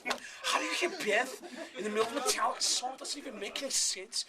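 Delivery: high, indignant imitation of a baffled rock drummer; mock disbelief. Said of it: "he started debating on air".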